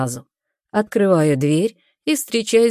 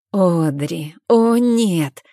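theatrical; 3 dB louder, much slower than usual. Acted. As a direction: ham up the horror. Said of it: "театрально прижимает руки к груди".

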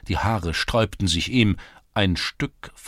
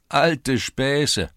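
mocking scoff; 8 semitones higher, 6 dB louder than usual. A narrator's reading. Lads mock his excuse.

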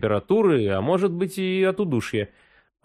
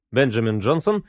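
plain reading voice

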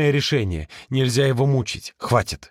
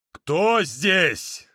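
a stern barked question; barking demand; 3 semitones higher than usual. Acted. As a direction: bark it at the room. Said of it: "рявкнул Эр громче".

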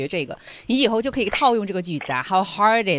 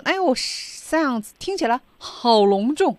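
higher, drawn-out admiring voice; impressed gushing; 5 semitones higher, 4 dB louder, slower than usual. Acted. Friend reacting to the ice sculpture plan, impressed and drawn out. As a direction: gush over how grand it sounds.

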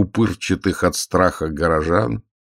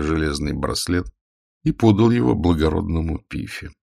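ordinary delivery, nothing marked

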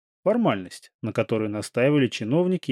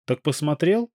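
impatient; 5 semitones higher than usual. A narrator's reading. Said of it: "нетерпеливо и нервно повторила Марина".

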